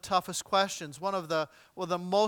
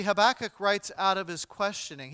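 ordinary talk

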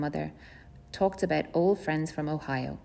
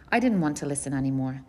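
ordinary delivery

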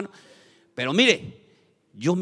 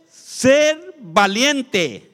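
very slow, loud and higher, stretched out; shouted key phrase; 8 semitones higher, 8 dB louder, much slower than usual. Shown as a demonstration. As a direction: bellow the point out syllable by syllable.

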